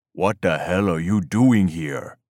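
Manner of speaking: a hard-voiced stranger demanding answers; stern demand. A narrator's reading. Youngfeather confronts trespassing Mike, stern and slow. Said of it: "a stern voice demanded".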